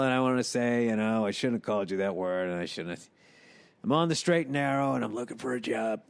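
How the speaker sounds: low, subdued voice of an apologetic robber; contrite ex-con. Acted.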